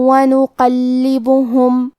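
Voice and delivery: ونقلبہم slow, the nunation merged into و with a nasal hum, لّ doubled; idgham with ghunna. Shown as a demonstration. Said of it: "غنہ اور تشدید کے ساتھ ادا کریں". (ونقلبہم غنہ اور تشدید کے ساتھ)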